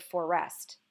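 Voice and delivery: "forest" said as for-REST, weight on the second syllable; wrong stress. Shown as a demonstration. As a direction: misplace the stress in "forest" onto -est. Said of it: "we don't say the word".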